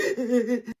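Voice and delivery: a sharp, loud imitated gasp of shock; mock gasp. Imitating the groom's mum gasping in shock. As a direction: act out the mum's horrified gasp. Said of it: "She's like".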